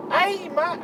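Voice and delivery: high, nasal-free Mancunian "Hey, mate" imitation; Manc accent. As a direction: mimic a Manc lad calling out. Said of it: "I can't language with that".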